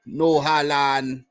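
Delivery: lilting Jamaican-style patter; Jamaican accent. Acted. Host brushing off a question about Haaland, Jamaican accent. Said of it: "you're trying to do the Jamaican accent".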